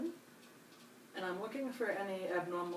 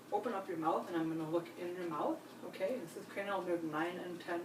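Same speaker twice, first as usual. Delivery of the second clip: usual speaking voice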